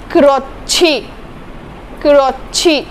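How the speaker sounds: क्रोच्छी with a breathy chh; aspirated ch demo. Shown as a demonstration. Teacher stressing the chh sound. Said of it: "इसकी sound आएगी छ की".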